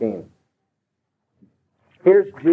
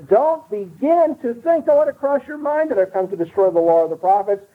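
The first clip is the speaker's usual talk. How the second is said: higher pitched, urgent repeat of Jesus' words; preacher voicing Jesus with a raised, urgent pitch; emphatic quote; 8 semitones higher than usual. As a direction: press it home as a warning. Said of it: "to make his point emphatic".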